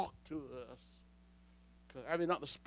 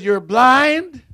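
loud, higher rebuke; reading the riot act; 6 semitones higher, 11 dB louder than usual. Acted. Preacher voicing God to Laodicea, riot-act rebuke. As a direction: hurl it as a verdict. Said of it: "he's telling her the riot act".

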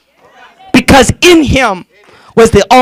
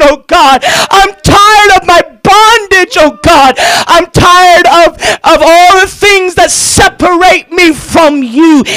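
raised, near-tearful cry to God; pitched-up confessional prayer, half sob; pleading repentance; 4 semitones higher than usual. Acted. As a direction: plead like a sinner at the altar. Voicing a repentant sinner praying.

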